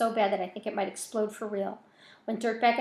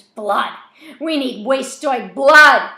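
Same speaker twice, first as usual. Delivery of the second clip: loud, slow, higher shriek; screaming; 4 semitones higher, 17 dB louder, much slower than usual. A narrator's reading. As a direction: scream it out with bloodlust. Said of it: "Rat screams".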